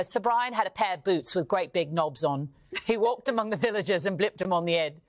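poem recited in a Cockney accent. Shown as a demonstration. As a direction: put on a Cockney accent for a verse. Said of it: "has a Cockney accent".

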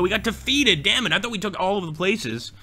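high, frustrated disbelief at losing; annoyed gamer realizing he lost; dismay. Real, not acted. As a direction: groan it out, not believing the loss. Losing the match.

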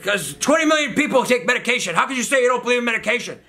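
high-pitched, outraged mock tirade; imitated ranting doctor. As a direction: rant in a high, affronted doctor voice. Doctor ranting about medication, retold.

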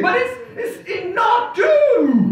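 flustered protest. Acted. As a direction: splutter in disbelief. Stammering protest against cheat claim.

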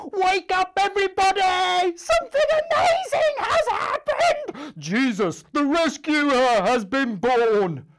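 breathless joyful call; excited calling out. Acted. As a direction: wake the town with news. Shepherds calling everyone.

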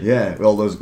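normal talking voice

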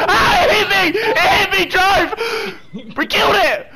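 very high, loud screaming; shrieking in terror and laughter; panicking gamer. Real, not acted. Bear attacking outside the car.

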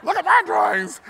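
put-on high, small little-kid voice; high childlike plea to a hero; timid kid voice. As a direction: squeak it out like a nervous child. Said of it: "be like".

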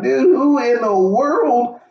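high, slow, amazed question; stunned disbelief. Retold or imitated. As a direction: gasp it out in wonder. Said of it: "You're like".

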